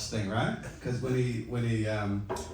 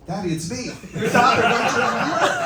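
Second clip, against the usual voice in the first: put-on high, rising, much louder child's voice, laughing through it; mock little daughter; 11 semitones higher, 10 dB louder than usual. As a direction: play the little girl calling loudly through the door, giggling.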